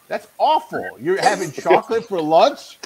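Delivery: lightly voiced outraged classmate; mock disgusted kid. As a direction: act shocked like a little kid. Kids mocking his lunch.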